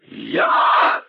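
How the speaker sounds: harsh, stretched, incredulous outburst; speechless rage. A narrator's reading. Great python repeats the insult, speechless with rage. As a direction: choke it out, lost for words with anger. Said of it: "не находя слов от гнева".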